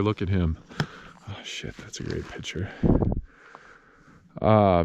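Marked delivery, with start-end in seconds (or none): 1.3-2.7 hushed under-the-breath remark; whispered aside. Real, not acted. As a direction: whisper it so nobody nearby hears. Aside while photographing the rancher.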